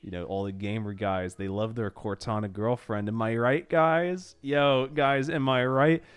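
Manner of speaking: ordinary delivery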